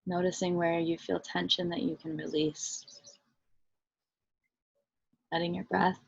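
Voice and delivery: soft, unhurried meditation voice with long pauses; guided meditation. Real, not acted. Teacher leading listeners into body and breath, guided meditation. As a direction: guide them gently inward, softly and evenly.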